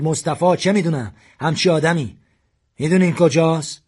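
armed man shouting at captives, furious. A narrator's reading. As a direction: yell it at them, gun waving. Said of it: "داد زد".

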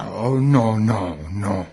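very low, understated angry no; deep-pitched, half-hearted anger; mock angry refusal. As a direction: grumble a low, foreign-guest refusal. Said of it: "عصبانی شد و نو گفت".

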